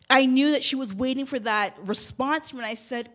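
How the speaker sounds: ordinary talk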